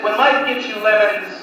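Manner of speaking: ordinary talk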